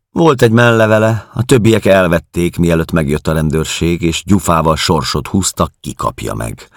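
high, loud gossiping caretaker; caretaker woman. Acted. Narrator voices the caretaker woman. (magas hangon mesélő házmesternő)

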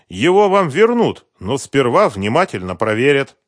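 loud, high, emphatic investigator; incredulous investigator. A narrator's reading. Investigator correcting a husband who tore up photos, incredulous.

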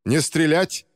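acted cry to hold fire, moderate volume; shouted order. A narrator's reading. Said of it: "крикнула Ли". (командный окрик)

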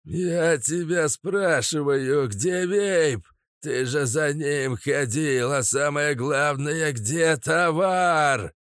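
slow, cold furious boss; steely rage. Acted. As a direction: menace him with steel in the voice. Gyurza interrogates furiously.

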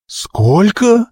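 high, rising, incredulous question; wide-eyed astonishment. A narrator's reading. Wheelwright hearing a huge order, astonished. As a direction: blurt it in high-pitched disbelief. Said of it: "округлил глаза Алвин".

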